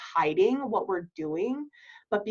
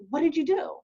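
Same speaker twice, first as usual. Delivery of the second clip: much higher, eager What did you do; curious friend; 8 semitones higher than usual. Pretend. Host answering a friend's shocking news, eager. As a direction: jump in like a nosy friend. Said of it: "I'm gonna be like".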